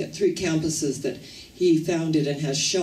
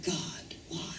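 breathy whisper to God; whispered plea; 13 dB quieter than usual. Acted. The poem's speaker whispering to God, hushed whisper. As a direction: whisper the question upward, barely voiced. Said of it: "I whispered".

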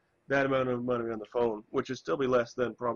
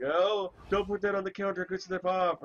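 high, precious fretting; fussy overprotective voice; 8 semitones higher, 4 dB louder than usual. Retold or imitated. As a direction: fuss over the phone like it's glass. Mocking her own fussy phone care.

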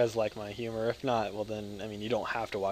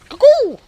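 shrill, much higher, much louder bird call; cuckoo call; 18 dB louder than usual. Pretend. Vlogger shows how out of his mind he is, shrieking cuckoo call. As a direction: screech it like a cuckoo clock. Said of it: "making random noises, like that".

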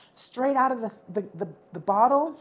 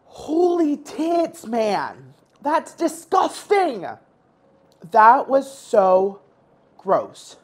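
disgusted; 8 semitones higher, 5 dB louder, much slower than usual. Real, not acted. Reacting to awful cherry.

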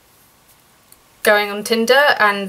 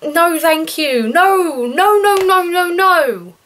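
repulsed string of no; 5 semitones higher, much slower than usual. Retold or imitated. Repulsed refusal of the photo.

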